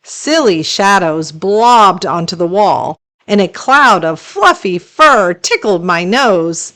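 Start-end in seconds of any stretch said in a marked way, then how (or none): none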